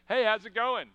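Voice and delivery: loud, cheery hello to a widow; clueless cheery greeting at a wake; breezy greeting. Acted. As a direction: toss off a casual, upbeat greeting. Acted greeting to a grieving widow.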